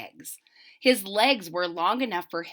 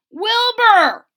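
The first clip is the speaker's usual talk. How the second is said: loud calling shout; 12 semitones higher, 11 dB louder than usual. Acted. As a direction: yell his name through the door. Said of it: "shouted Hector".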